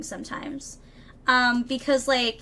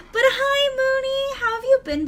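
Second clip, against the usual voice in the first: very high, louder singsong greeting; gushing greeting; 12 semitones higher, 4 dB louder than usual. Real, not acted. Streamer greeting a returning viewer, high gushing singsong. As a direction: pitch it way up, cooing hello.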